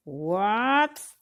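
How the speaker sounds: usual speaking voice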